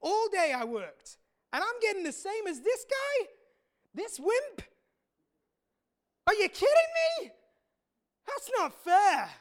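stamp your feet at the injustice; aggrieved worker shouting at the master; furious rant. Acted. Big worker rants at master.

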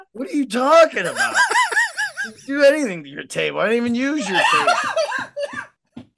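laughing protest. Retold or imitated. Retelling own baffled reply, laughing.

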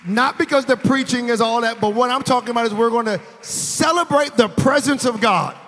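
very loud, high preaching over music; preaching at full force. Acted.

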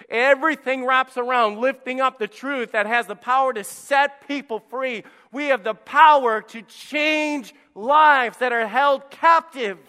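high, loud preaching shout; full-force preaching. Acted. Preacher shouting the truth at his church, loud and high. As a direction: drive it home to the congregation.

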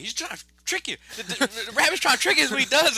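high-pitched, urgent fox voice; Brer Fox. Retold or imitated. Fox trying to warn bear of a trick, high urgent voice. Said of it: "Brer Fox trying to tell him".

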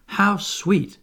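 put-on a high, delighted cry of a girl; lightly voiced Wendy crying out in delight. A narrator's reading. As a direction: gush over it, charmed. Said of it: "cried Wendy".

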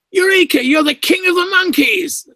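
high, loud cry of triumph; hammed-up, high-pitched jubilant proclamation; jubilant eureka. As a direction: imitate a director leaping up in delight. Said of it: "he jumped up from his, his chair".

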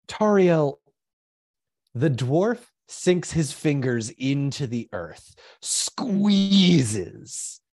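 suspenseful storytelling with long pauses; game master's narration. Acted. Game master revealing the spell's result to players, suspenseful narration. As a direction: draw out the key moments, then let it hang.

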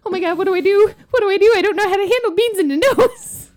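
high, fast, loud mock panic; panicked mom. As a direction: spiral into parent panic, laughing at the end. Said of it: "And I was like".

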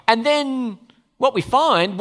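ordinary talk

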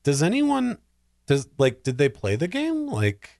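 mocking moviegoer asks if the filmmakers played the game, high and baffled; incredulous disbelief. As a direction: ask it like you can't believe anyone missed the point. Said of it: "you're just like".

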